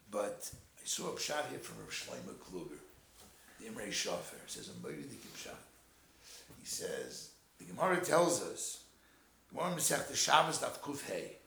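usual speaking voice

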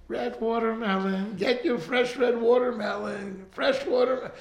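put-on a little kid parroting the seller; child repeating. Small boy copying the vendor, light child voice. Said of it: "the kid starts saying".

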